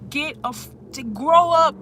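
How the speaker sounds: sudden loud, high-pitched burst of frustration; exasperated outburst. Real, not acted. Ranting at viewers about age, bursting out loud. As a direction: blurt it out, fed up.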